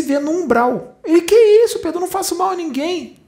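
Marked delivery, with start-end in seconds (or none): 1.0-2.0 high, loud indignant protest; shocked retort. Retold or imitated. Man protesting to Pedro, shocked and high. 2.0-3.2 high-pitched offended protest; indignant denial. Retold or imitated. Man defending himself to Pedro, high and indignant.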